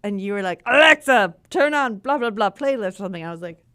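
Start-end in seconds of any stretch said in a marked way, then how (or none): 0.7-2.9 calling out. Retold or imitated. Calling out to Alexa.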